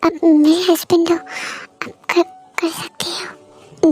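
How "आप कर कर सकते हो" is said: barely voiced, slow tearful whisper. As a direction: breathe the words out through tears.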